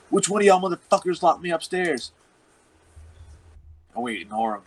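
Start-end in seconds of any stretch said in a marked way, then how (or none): 0.1-2.1 raised, pitched-up angry demand; hammed-up retelling of the friend demanding who locked him in. Friend storms downstairs demanding answers, higher and angrier. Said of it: "and he's like".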